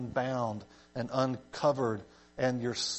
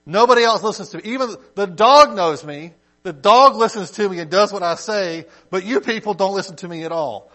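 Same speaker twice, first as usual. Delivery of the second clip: raised, high-pitched mock griping; exasperated complaint; 5 semitones higher, 8 dB louder than usual. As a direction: build to a loud, put-upon rant. Acted complaint of an ignored master.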